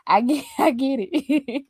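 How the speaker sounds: ordinary talk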